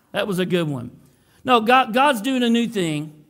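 usual speaking voice

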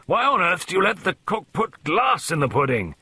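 high, blustering outrage; indignant colonel at full bluster; roaring complaint. A narrator's reading. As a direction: demand an explanation in a fury. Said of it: "he roared".

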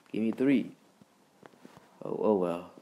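usual speaking voice

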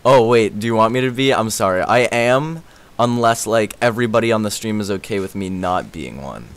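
normal talking voice

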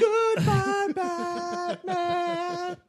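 very high, drawn-out farewell; hammed-up falsetto goodbye; falsetto mock Superman. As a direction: stretch it out in a high wail.

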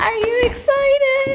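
very high, sing-song excited question. Real, not acted. Repeats question to child, higher.

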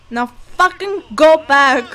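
slow, loud, drawn-out command; loud order. Real, not acted. Ordering the dog back, loud and slow. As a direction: bark the order slowly.

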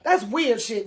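usual speaking voice

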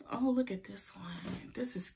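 whispering. Real, not acted. Whispered while showing shirt.

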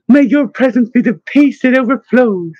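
high, joyful exclamation; ecstatic blessing. A narrator's reading. Elder sister blessing the cherished younger Lorna, ecstatic. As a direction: bless them with a lifted, delighted voice. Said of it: "Sister Bryce was ecstatic".

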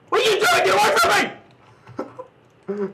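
high screaming in fright; panicked shriek. Real, not acted. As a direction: shriek it, terrified of the thing. Shrieking at a walking fetus.

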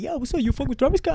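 high-pitched, eager mimicked question; acted excited friend. Photographer friend asking about a rapper, acted excitement.

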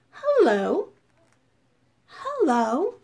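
medium-volume hello with a lift at the end; bold yet gentle. Shown as a demonstration. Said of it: "in a nice medium sounding voice. Bold yet gentle".

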